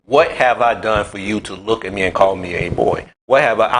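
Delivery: ordinary talk, nothing marked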